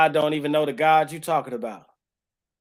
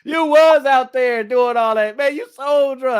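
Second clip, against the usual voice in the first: high, loud taunting voice; mock accusing friend; 8 semitones higher, 6 dB louder than usual. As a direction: call him out like an old buddy from the block. Imitating someone calling him out.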